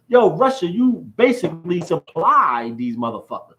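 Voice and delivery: loud, high-pitched incredulous yelling; shouted rant. Real, not acted. Man taunting Russia over its soldiers fleeing, shouting. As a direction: build to a high, mocking shout.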